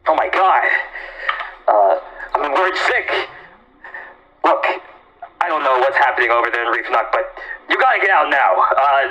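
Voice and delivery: loud, worried dad voice changer; alarmed father through a voice changer. Acted. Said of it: "I'll do a dad voice".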